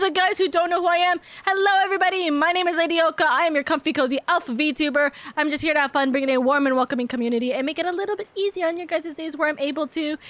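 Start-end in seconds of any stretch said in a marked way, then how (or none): none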